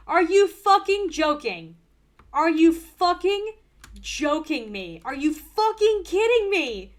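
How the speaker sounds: gasping, yelling in disbelief; loud disbelieving yell at the game; shocked shout. Real, not acted. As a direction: scream it in real outrage at the game. Real outburst at finding no frogs.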